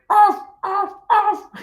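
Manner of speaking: very high yappy little dog; very high playful barking. Pretend. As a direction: yap it like a tiny dog. Dog sound in children's song.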